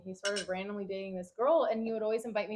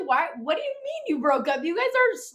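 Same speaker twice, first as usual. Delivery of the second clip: higher and louder, can't believe it; incredulous outburst; 9 semitones higher, 8 dB louder than usual. Retold or imitated. Nosy friend quizzing the couple, disbelieving. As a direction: demand answers, amazed. She imitates herself being nosy.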